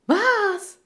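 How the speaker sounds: high, loud shocked exclamation; sudden shriek of dismay; horrified cry. A narrator's reading. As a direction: cry out aghast. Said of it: "rief Lee entsetzt".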